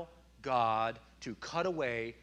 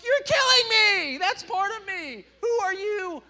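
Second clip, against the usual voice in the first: imitating crying toddler; 14 dB louder than usual. Pastor imitating his son screaming at the barber, crying shout. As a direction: sob and yell like a little kid losing his hair. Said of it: "He's like".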